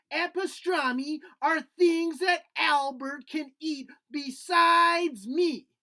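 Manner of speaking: bright little puppet explaining eagerly; puppet Nutley. Acted. Puppet reads its food list.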